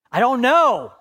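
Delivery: a loud, high, exasperated I don't know; frustrated outburst. Retold or imitated. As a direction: blurt it out in frustration. His frustrated reply to Jesus.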